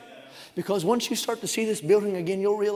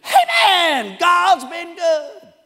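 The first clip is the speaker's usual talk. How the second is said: high, booming, drawn-out holler; hollered amen; 12 semitones higher, 11 dB louder, much slower than usual. Retold or imitated. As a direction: imitate an uninhibited worshipper bellowing over the hymn. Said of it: "He about ruined our doxology".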